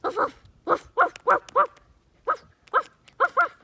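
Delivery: high, loud playful woofs, building; barking. Pretend. Kid voicing her dog, barking at the cat. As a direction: bark like a little dog at the cat. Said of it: "bark at a cat".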